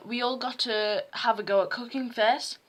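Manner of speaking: usual speaking voice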